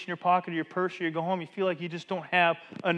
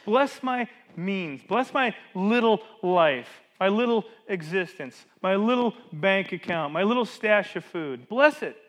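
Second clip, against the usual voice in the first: slow, louder, earnest prayer building to a loud final plea; fervent prayer; 4 dB louder, slower than usual. Shown as a demonstration. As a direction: pray it out slowly, pressing each little, then cry out the last bless. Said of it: "turn to God and say".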